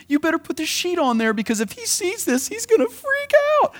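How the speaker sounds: high, hushed then squealing fret; a mock-frightened whisper then squeak; mock fearful warning. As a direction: act out a panicked cover-up. Mock hiding things from God.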